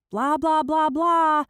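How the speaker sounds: loud, high blah-blah drowning them out; calling out. Acted. Laura, ears covered, drowning out her dad, loud and high. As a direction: yell nonsense to block out the parents. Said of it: "I call out, covering my ears".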